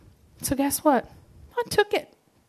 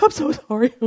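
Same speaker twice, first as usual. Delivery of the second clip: higher, mock-contrite little voice; sorry child; 5 semitones higher than usual. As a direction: play the instantly sorry kid. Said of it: "I would be like".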